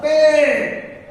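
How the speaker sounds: "Pay" held long and loud; long sound. Shown as a demonstration. Teacher demonstrating a long sound, "Pay" loudly stretched. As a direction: draw out the vowel in "Pay". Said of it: "We are taking a long sound".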